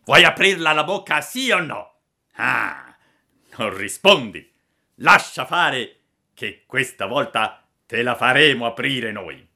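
loud, bullying villain voice; threatening villains. A narrator's reading. Assassins force puppet's mouth open, loud menace. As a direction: demand and threaten at high volume.

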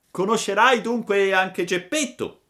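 loud, high, projected question; pigeon character voice. A narrator's reading. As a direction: call out the question as the big pigeon. Pigeon calls a question to puppet.

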